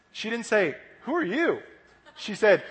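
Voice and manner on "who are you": high-pitched puzzled question; mock confusion. Mary not knowing the risen Jesus, mock confusion. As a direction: ask it as if you don't recognize them.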